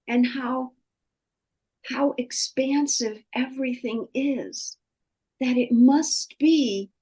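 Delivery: high voice, still crying; halting, tearful phrases. Real, not acted. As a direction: push the thought out through tears. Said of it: "I'm crying right now".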